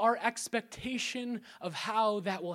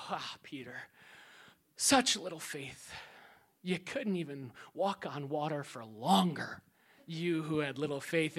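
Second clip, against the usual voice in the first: low scornful tone; mocking scold; 7 semitones lower, 6 dB quieter than usual. Critics scoffing at Peter, low mocking scold. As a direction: sneer at Peter's weak faith. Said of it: "we always use this scripture to go".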